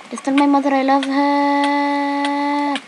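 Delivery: hammed-up dying soldier's last words; mock farewell from the battlefield; wounded soldier gasping.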